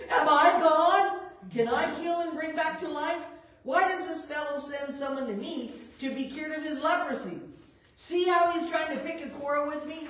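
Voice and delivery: mock-anguished royal lament; distressed cry. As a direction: read it as a king in panic. King tears robes in distress.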